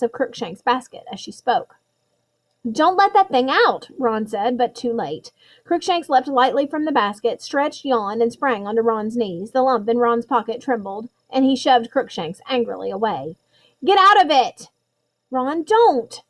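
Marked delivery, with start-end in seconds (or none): 2.7-3.9 urgent warning. Acted. Ron warns about the cat. 13.8-14.7 sharp angry yell; angry shout. Acted. Ron shoves cat away. 15.3-16.2 angry. Acted. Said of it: "said Hermione angrily".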